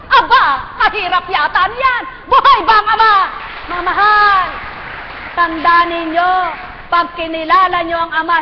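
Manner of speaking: high, loud preacher's shout; shouting sermon to the congregation; preaching at full force. Real, not acted. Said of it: "napapasigaw ako eh".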